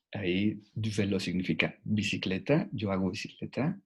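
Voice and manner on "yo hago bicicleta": ordinary talk, nothing marked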